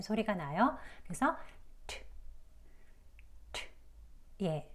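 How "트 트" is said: 트 as a bare puffed English t; voiceless 트 with no vowel, just a burst of air; aspirated alveolar 트. Shown as a demonstration. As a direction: say 트 with tongue tip on the ridge, letting air burst out. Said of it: "바람 소리랑 같이 나오는 트 소리가 나요".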